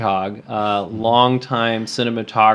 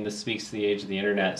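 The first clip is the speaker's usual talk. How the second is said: normal talking voice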